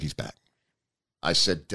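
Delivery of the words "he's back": much softer, lower, no scream at all; just say it quietly instead of screaming; the wife says it softly, not screaming. Retold or imitated. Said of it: "She didn't scream it, but she said".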